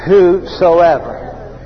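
long, stretched-out "Whosoever"; drawn-out call. Shown as a demonstration. Preacher leading the congregation in saying it, slowly drawn out. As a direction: draw out every syllable for others to join in. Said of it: "let's all say that".

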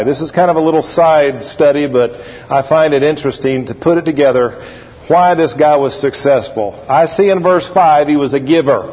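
ordinary talk, nothing marked

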